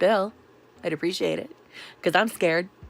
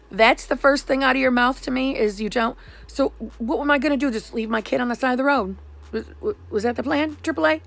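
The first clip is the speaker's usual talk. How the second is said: indignant, higher-pitched venting; ticked off; 7 semitones higher than usual. Retold or imitated. Ticked-off caller challenging triple A about passengers, indignant. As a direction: fire back annoyed questions in a raised pitch. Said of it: "I was instantly ticked off".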